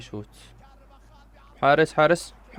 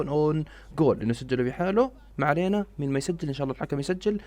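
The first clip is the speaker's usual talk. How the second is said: ordinary talk, nothing marked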